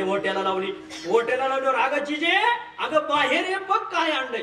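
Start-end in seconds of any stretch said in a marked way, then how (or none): 1.8-4.4 higher, cheerful calling out; calling to wife. Retold or imitated. God calls Jijabai outside to show the gifts, higher. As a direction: call her out of the house.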